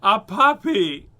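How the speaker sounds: loud, drawn-out high exclamation; hammed-up thrill; excited shout. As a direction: belt it out as the big surprise. Joke answer to the surprise question.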